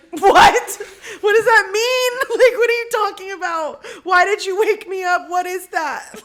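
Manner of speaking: high, loud mock indignation through laughter; annoyed bafflement. Imitating just-woken boyfriend, laughing annoyance. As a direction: demand answers like someone rudely woken. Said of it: "obviously annoyed that I just woke him up".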